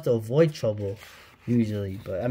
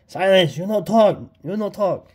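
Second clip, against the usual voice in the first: loud, high mock command; villain hamming up a loud order to shut up; comic villain shout; 6 semitones higher, 6 dB louder than usual. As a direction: bark it at the prisoner, read straight off the comic page.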